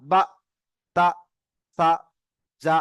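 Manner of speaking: "বা, তা, সা, যা" each short, separated by pauses; short unstretched syllables. Shown as a demonstration. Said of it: "টান হবে না". ("বা, তা, সা, যা" টান ছাড়া)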